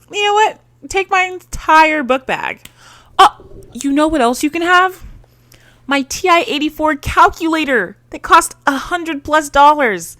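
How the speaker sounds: high, breathless mock giddiness; giddy gushing. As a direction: gush like a smitten girl handing over everything. Giddy schoolgirl giving everything away.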